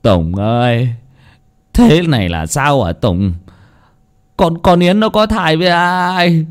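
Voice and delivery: slow, mildly tearful lament; a hint of an old father holding back tears; father ashamed and grieving over his daughter. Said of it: "Ông Vui mếu máo".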